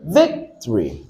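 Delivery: "Victory" as VIC-tree, falling away after VIC; front-stressed "Victory", falling; correct first-syllable stress. Shown as a demonstration. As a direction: stress VIC in "Victory" and let the end drop. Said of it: "what's the correct stress".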